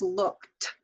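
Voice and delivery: "looked" said slowly ending in a clear T; T-sound ending. Shown as a demonstration. Teacher to students, modeling the T ending slowly. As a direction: finish "looked" on a clean T. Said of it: "sound like a T".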